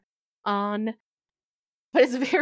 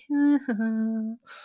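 high, closed-mouth nervous giggle; awkward little laugh; 6 semitones higher than usual. Acted. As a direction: stall with an uneasy, high titter. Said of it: "looks back at you guys like".

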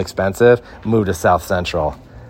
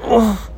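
oof. Retold or imitated. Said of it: "I was like".

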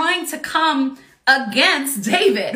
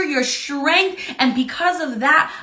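ordinary delivery

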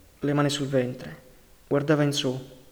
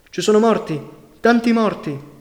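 loud, higher panicked shout; shouted back; 6 semitones higher, 7 dB louder than usual. Acted. Young cyclist shouting back to the narrator, panicked and loud. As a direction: yell the news while racing downhill. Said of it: "Mi gridò di rimando".

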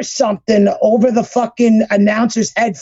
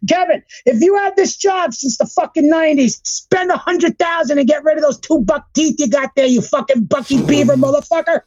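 high-pitched venting at a hated producer; furious rant; 6 semitones higher than usual. Real, not acted. Ranting straight at Kevin Dunn about Cody's entrance, furious and high. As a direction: tear into him, really worked up.

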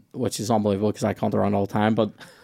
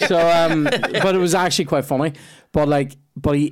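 ordinary delivery

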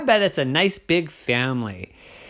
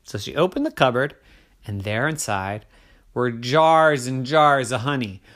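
ordinary delivery

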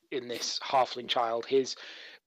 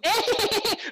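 shrill mocking giggle, much higher; a bratty child's cackle; high mocking kid laugh. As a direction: laugh down at them like a cheeky brat. Halfling leader mocks player.

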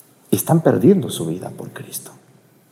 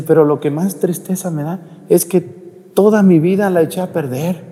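tearful sick man; 8 semitones higher than usual. Retold or imitated. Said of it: "ese señor estaba llorando".

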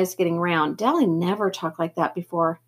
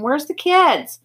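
shouting; 7 semitones higher, 3 dB louder, much slower than usual. A narrator's reading. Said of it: "Johnny shouted the next question".

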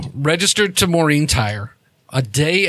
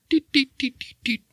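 high, short door-keypad beeps; rapid "deet" beeps, high-pitched; imitated electronic beeping; 12 semitones higher, 4 dB quieter than usual. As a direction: make little high-pitched beeps with your mouth. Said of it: "Jet, you hear".

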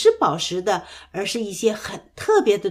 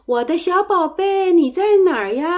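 mother duck calling, higher and louder; 6 semitones higher, 4 dB louder than usual. A narrator's reading. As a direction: call for a lost duckling, higher and louder. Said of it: "鸭妈妈呼唤着".

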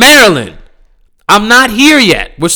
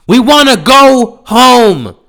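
higher, louder and slow, drawn-out plea; exasperated yell; 5 semitones higher, 4 dB louder, slower than usual. Real, not acted. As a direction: stretch it out like you're at your limit. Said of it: "peak of exasperated plea".

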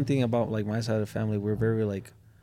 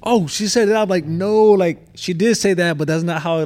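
much louder, higher, acted-out objection; retold loud protest; 8 semitones higher, 10 dB louder than usual. Son explaining mom's words to offended girlfriend, loud protest. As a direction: burst out defending mom, loud and high. Retells translation dispute with girlfriend.

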